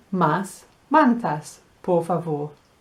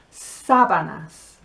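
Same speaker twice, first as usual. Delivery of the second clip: "sábanas" slowly as SA-ba-nas; first-syllable stress; 4 semitones lower than usual. Shown as a demonstration. Teacher introducing the word for sheets, slow SA-stressed "sábanas". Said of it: "accent on the first syllable".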